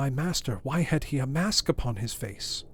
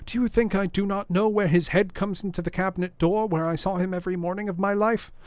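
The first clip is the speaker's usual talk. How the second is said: loud, higher, pleading butler; impassioned outcry; 7 semitones higher, 4 dB louder than usual. A narrator's reading. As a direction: insist to the lawyer, near shouting. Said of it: "cried Poole".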